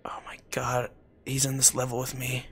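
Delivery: real hushed breath-only voice; frightened whisper. Real, not acted. Player realizing the monster is near, whispering in fear. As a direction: whisper it in dread.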